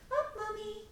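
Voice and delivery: put-on high, sweet child asking; happy toddler. Imitating a happy child asking mommy to pick him up, high. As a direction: ask sweetly like a small child. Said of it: "happy child will be coming to you going".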